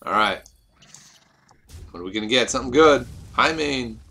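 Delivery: ordinary talk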